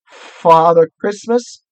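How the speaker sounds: halting answer from a nervous fox; stammering fox. A narrator's reading. Fox answering the witch's question, stammering. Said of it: "stammered the fox".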